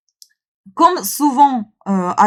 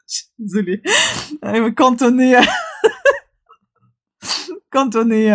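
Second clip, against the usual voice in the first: giggling; 2 semitones higher than usual. Real, not acted. Still laughing after blooper.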